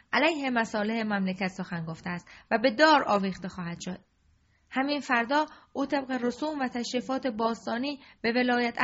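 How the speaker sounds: loud, commanding royal decree; king sternly ordering his courtiers, loud; harsh tone. Acted. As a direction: lay down the law in a fury. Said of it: "با همان لحن تند".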